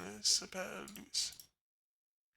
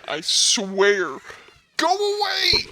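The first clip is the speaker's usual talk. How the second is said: much louder, higher exasperated yell; 9 semitones higher, 17 dB louder, slower than usual. Real, not acted. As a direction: lose patience and yell. Exasperated at sibling again.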